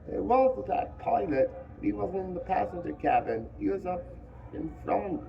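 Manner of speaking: put-on the fat boy, worried about the pilot. A narrator's reading.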